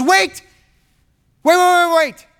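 high, loud, urgent cry of protest; alarmed acted plea to stop; urgent interruption. Judah begs Joseph to stop.

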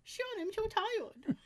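high, slow whine of exhaustion; whiny tired voice. Retold or imitated. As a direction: whine that you're too tired. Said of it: "and I'm like".

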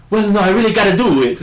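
put-on high, loud laughing Italian accent. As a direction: insist in a thick Italian accent. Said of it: "maybe it's the accent".

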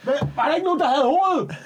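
high, alarmed curse; mock panic. Messenger realising they forgot the head, panicked. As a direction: act suddenly horrified at the blunder.

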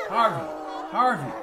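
high, sharp calls of a name; shouted. A narrator's reading. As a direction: call him back to consciousness. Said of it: "shouted Polanski".

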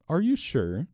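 high-pitched, tentative question; timid eagerness. A narrator's reading. Small priest questioning the gloating robber, timid and eager. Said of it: "with the timid eagerness of the private secretary".

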